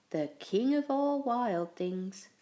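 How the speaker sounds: usual speaking voice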